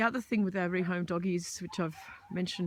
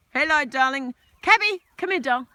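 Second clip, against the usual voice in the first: high, loud sing-song call; calling the dog; 7 semitones higher, 9 dB louder than usual. Real, not acted. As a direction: coax the dog over.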